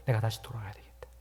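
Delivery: hushed, unvoiced vow; whispered resolve. Prodigal son whispering his resolve to go home. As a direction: whisper it like a quiet decision.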